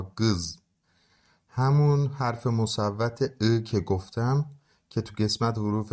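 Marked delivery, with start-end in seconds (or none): 0.0-0.6 گز repeated with the short vowel; correct vowel. Shown as a demonstration. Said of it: "گیز نه ها". (گز درست)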